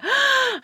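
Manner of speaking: high, sharp intake of delight; mock starstruck gasp; excited gasp. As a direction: gasp as if thrilled. Acts out being thrilled by voice.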